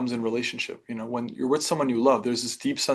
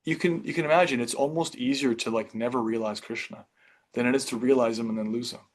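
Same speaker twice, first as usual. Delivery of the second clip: ordinary talk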